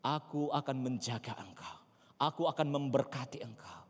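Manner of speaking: quiet, measured reassurance; soft divine promise. Acted. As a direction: repeat the promise gently and softly. Same promise repeated softly. (janji Tuhan diucapkan lembut)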